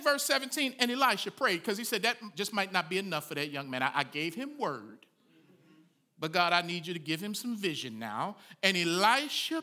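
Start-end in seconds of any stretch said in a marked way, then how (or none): none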